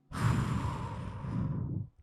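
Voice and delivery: long, airy exhale acting out relief; big demonstrative sigh of relief. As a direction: breathe out slowly, as if relieved. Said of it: "there was a sigh of relief".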